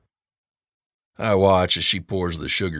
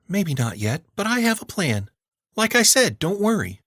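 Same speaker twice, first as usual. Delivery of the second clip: put-on higher, breezy girl voice; teen girl voice; 13 semitones higher than usual. A narrator's reading. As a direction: play the cocky niece. Girl insists she has a plan.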